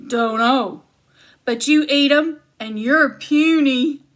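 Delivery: big giant stomping in for lunch; booming giant. Acted. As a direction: boom each syllable like a giant. Giant retorts.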